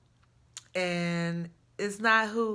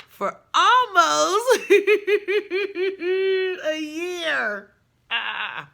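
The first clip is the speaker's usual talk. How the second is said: laughing; 8 semitones higher, 5 dB louder, much slower than usual. Real, not acted. Laughing, near tears.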